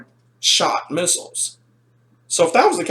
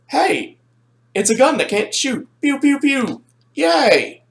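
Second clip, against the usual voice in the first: mock playful cheer; 7 semitones higher, slower than usual. Pretends to play with broken toy.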